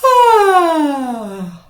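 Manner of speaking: voiced yawn sighing down from high and loud; loud yawn with sound. Shown as a demonstration. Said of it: "That's a yawn with a sound".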